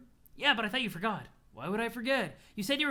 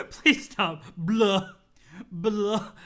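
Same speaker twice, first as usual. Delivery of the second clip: laughing reaction; 8 dB louder, much slower than usual. Real, not acted. Laughing reaction to meme image.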